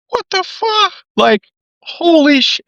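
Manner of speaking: high breathy stunned exclamation; shocked disbelief. Retold or imitated. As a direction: gasp it out in pure shock. Retold shock at voice message.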